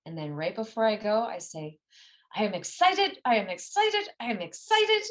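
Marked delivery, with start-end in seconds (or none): 2.3-5.0 high, loud pep chant, building; hyped, slightly dorky mantra; excited self-affirmation. Shown as a demonstration. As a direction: psych yourself up, louder and much higher. Demonstrates pumped-up pre-run affirmation.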